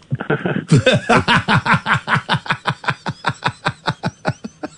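high giggling fit; cracking up uncontrollably; helpless laughter. Real, not acted. Host cracks up on air.

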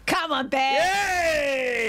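very slow, drawn-out call. Pretend. Host calling out to imaginary bears. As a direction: stretch it out like calling animals in.

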